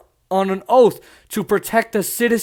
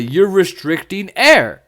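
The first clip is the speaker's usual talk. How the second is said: normal talking voice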